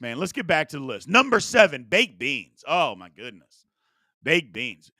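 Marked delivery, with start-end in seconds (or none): none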